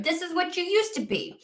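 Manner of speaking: other-perspective voice. Acted. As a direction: speak as a different self. Voicing a different perspective.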